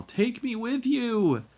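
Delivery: put-on high, loud, drawn-out child's howl. A narrator's reading. Boy begs as his friend boards the train, howling. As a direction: howl it out as a desperate plea. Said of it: "Jamie began to howl".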